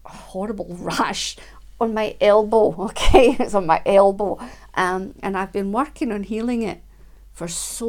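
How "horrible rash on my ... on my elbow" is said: louder, bursting into laughter over it; laughing confession. Real, not acted. Telling viewers about her elbow rash, laughing loudly. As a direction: laugh at your own embarrassment.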